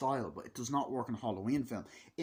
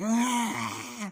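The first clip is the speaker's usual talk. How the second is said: put-on high, loud baby cry; imitated baby wail; 12 semitones higher, 6 dB louder than usual. Film baby wailing amid the quarrel, imitated cry.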